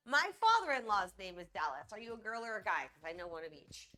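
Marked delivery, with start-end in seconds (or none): none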